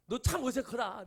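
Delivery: slow, high and loud, teasing himself; mock scolding the mirror. Pastor mocking himself in the mirror while brushing, slow loud jab. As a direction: drag out a loud, mocking jab at your own reflection. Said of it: "내가 그러면서".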